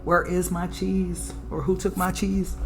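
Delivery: normal talking voice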